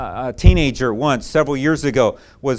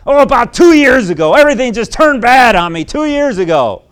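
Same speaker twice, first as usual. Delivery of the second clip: put-on bitter teenager; 8 semitones higher, 10 dB louder than usual. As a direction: voice the angry teen, louder and higher. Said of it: "He said".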